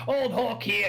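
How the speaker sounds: high, hearty Australian tavern voice; boisterous Australian showing off a mate; Australian accent. Acted. Drunk local presents Hork to the newcomer, hearty Australian accent. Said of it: "that's my Australian accent".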